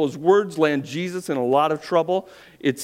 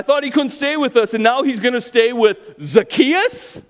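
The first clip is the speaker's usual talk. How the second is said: much higher, loud outraged complaint; indignant grumbling crowd; 10 semitones higher, 7 dB louder than usual. Acted. Preacher acts the grumbling crowd, outraged, much higher and loud. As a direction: rail against Jesus as a betrayed bystander. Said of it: "began to grumble".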